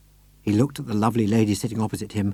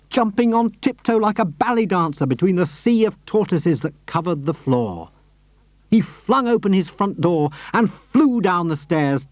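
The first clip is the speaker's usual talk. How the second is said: ordinary delivery, nothing marked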